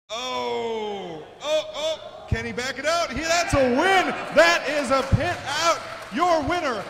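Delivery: high, loud, ecstatic yelling; excited shout. Real, not acted. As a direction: roar it out as the bot escapes. Pit-out win called live.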